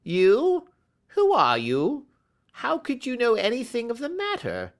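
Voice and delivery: alarmed stranger demanding answers; startled little man. Acted. Ryder startled.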